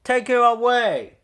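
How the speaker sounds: very loud, high furious bellow. A narrator's reading. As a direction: bellow it over the whistle noise. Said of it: "he bellowed".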